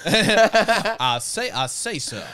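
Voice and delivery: hammed-up Southern rooster voice, laughing at the end; Foghorn Leghorn. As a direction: boom it like a cartoon rooster. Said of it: "Foghorn Leghorn".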